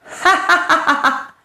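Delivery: hammed-up scornful cackle; the bandit laughs off his rival, cackling; villain's mocking laugh.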